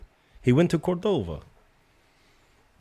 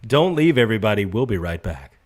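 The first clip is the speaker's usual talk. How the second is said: TV host voice; 3 semitones lower, 5 dB louder, faster than usual. Pretend. Mock show break announcement.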